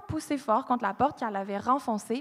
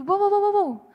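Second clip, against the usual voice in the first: high, loud alarmed wow wow; urgent stop; 9 semitones higher, 7 dB louder than usual. Retold or imitated. Dispatcher halting Jennifer from opening the door, high and loud. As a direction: cut her off before she opens the door.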